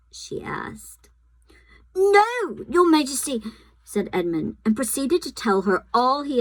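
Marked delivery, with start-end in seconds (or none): 1.9-3.4 nervous. A narrator's reading. Edmund answers nervously.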